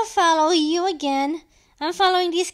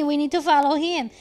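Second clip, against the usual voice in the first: ordinary delivery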